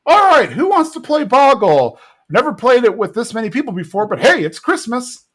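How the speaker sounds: high hostess rallying the guests; hammed-up party cheer; chirpy Peggy. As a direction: open loud, keep it high and upbeat. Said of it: "and Peggy's like".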